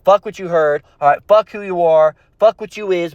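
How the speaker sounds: ordinary talk, nothing marked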